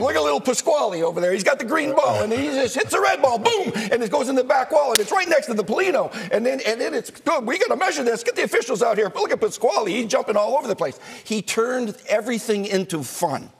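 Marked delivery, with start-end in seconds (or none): 0.0-11.0 John Madden impression. [name] imitating Madden broadcasting the bocce tournament, excited and high. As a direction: ham up a booming excited announcer calling bocce. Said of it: "here he goes. He goes".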